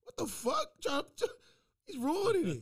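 high-pitched, agitated objection; exasperated protest. Real, not acted. Host protesting that the bit is being ruined, high exasperation. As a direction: cut in, voice jumping up in disbelief.

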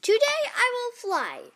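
high, loud declaration of intent; excited Piggy. Acted. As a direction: announce it boldly, pitched right up. Piggy declares she will fly.

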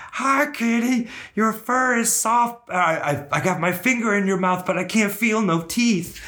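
very high cartoon voice, slow in places; little movie character. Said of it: "it's the voice of the little".

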